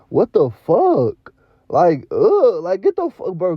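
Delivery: loud, disgusted "what the fuck"; disgusted outburst. Real, not acted. Fan yelling at the screen over the pin, loud and disgusted. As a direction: blurt it out in real disgust at the replay.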